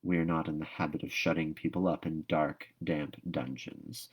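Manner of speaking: dryly deflating her drama. Acted. Marilla puncturing Anne's drama, dry and quiet. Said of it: "said Marilla dryly".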